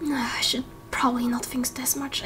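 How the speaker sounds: soft, sighing whisper; whispered to herself. Acted. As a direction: muse it under the breath after a sigh. Sighing to herself after the visit.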